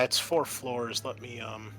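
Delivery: normal talking voice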